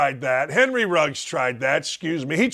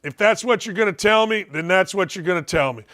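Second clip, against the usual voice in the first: usual speaking voice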